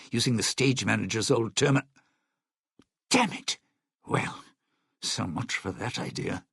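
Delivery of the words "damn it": high-pitched burst of frustration; sudden exasperated outburst; frustrated curse. Acted. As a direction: curse as the plan fails. Plan fails as he is cut off.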